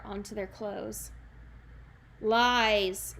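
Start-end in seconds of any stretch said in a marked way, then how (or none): 2.2-3.1 a loud booming word; bellowing giant. Acted. The giant bellows at Jack, a loud booming denial. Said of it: "the giant bellowed".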